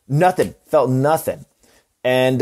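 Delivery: ordinary delivery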